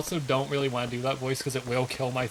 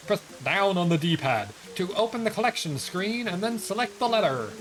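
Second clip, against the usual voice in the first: a touch higher cheerful postman; lightly voiced postman; 7 semitones higher than usual. Streamer reading a postman's lines, a bit higher. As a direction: play the letter carrier, a bit higher.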